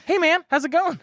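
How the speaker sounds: high, bright-pitched friendly hello like Aziz; friendly high greeting. Retold or imitated. Little dude greeting the band, high chirpy voice. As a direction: greet him cheerfully, way up high. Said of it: "picture Aziz coming up".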